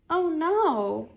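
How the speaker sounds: ordinary delivery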